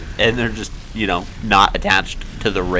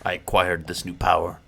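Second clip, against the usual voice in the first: quiet, lowered Clive impression; softer, lower mock Clive voice; imitating Clive; 4 semitones lower, 10 dB quieter than usual. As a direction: murmur it softly like a solemn hero. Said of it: "Just like Clive talks".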